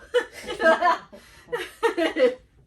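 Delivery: high, loud burst of laughter. Real, not acted. Ending spoiler section.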